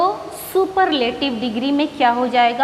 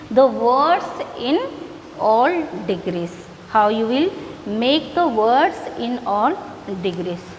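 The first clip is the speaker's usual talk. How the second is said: slow teacher recap; much slower than usual. Shown as a demonstration. Teacher summing up for students.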